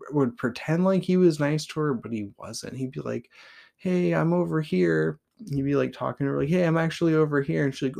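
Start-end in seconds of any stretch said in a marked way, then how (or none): 3.8-5.2 a hint of a sneering bully, higher and slow; lightly voiced cruel classmate calling from the side; mocking taunt. Bully taunting a blind girl. 6.5-7.7 quick, higher, lightly sneering bully; a hint of a teasing jock voice; mocking taunt. Bully taunting a blind girl.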